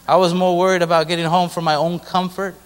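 ordinary talk

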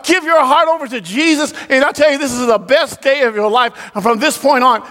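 high, driving preacher's voice; full-force preaching. Real, not acted. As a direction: preach it hard, pitched well above talk.

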